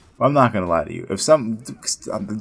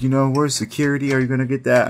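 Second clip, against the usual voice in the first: mock flustered demand; indignant demand; 6 semitones higher than usual. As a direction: act out pestering staff for help, pitched up. Tyler demands security in retold scene.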